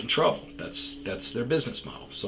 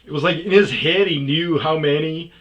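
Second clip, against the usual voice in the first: louder, higher excited telling; amused disbelief; 5 semitones higher, 8 dB louder than usual. Real, not acted. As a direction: still laughing it off.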